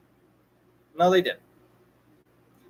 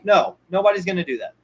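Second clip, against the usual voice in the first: ordinary talk, nothing marked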